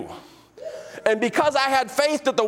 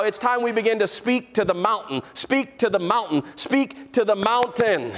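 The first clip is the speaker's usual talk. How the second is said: usual speaking voice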